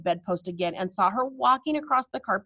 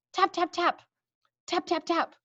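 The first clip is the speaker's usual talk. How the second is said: high, crisp tap imitation; boy tapping the bottle on the door; 9 semitones higher than usual. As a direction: imitate knocking.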